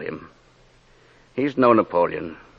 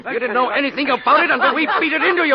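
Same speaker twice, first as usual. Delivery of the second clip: exasperated rapid scolding; faster than usual. Acted. Inspector berating the painter, fast and exasperated. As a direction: rattle it off in irritation.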